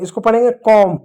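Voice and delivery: teacher modelling कॉम, the b dropped; कॉम with silent b. Shown as a demonstration. Teacher shows students the right way: कॉम, b silent. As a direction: say कॉम with no b sound at the end. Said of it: "कॉम्ब नहीं पढ़ेंगे".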